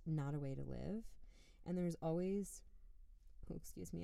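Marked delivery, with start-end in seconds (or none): 1.6-4.0 interrupted by a hiccup. Real, not acted. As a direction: catch a hiccup mid-sentence. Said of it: "I'm hiccuping from the wine".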